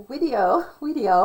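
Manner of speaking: video said as wideo with a W; mock German accent on video, W for V. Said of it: "he goes video with a W".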